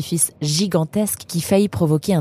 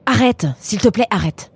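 higher-pitched, irritated plea to stop; exasperated plea; 6 semitones higher, 3 dB louder than usual. Acted. Eunice pleads with Nemrod to stop his silly breathing, exasperated. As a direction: beg him to quit, fed up.

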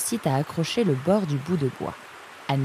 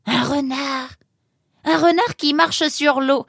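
high, slow wonder, growing louder; amazed; 10 semitones higher, 4 dB louder, slower than usual. Acted. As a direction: marvel at an unbelievable discovery. Amazed by the drawing.

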